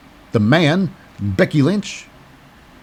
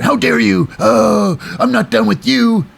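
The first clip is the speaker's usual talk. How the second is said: mock outrage; 9 semitones higher, slower than usual. Shotzi storming backstage at Bayley, hammed-up furious. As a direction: ham up a wronged wrestler.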